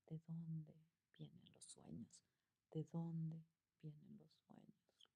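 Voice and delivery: dreamy voice fading to a whisper; fading into a sleepy whisper. Shown as a demonstration. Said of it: "nos lleva al mundo de los sueños".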